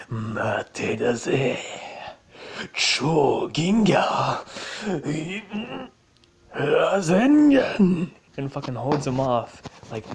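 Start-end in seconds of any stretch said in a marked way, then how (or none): 0.1-8.1 drawn-out, building to a loud battle cry; anime attack call. Retold or imitated. Antonora holding off the villain, hammed-up slow anime attack shouts. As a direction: stretch each move name slowly, swelling into a roar.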